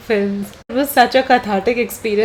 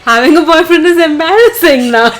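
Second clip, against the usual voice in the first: louder, higher insistence; emphatic repeat; 6 semitones higher, 7 dB louder than usual. Real, not acted. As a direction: declare it loudly, about to burst out laughing. Repeats it emphatically after being questioned.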